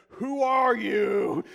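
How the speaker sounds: loud, drawn-out mock scream. Congregant suddenly interrupting the sermon, screaming out. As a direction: bellow it slowly like an outburst in church. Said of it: "suddenly just screaming out in the middle of the sermon".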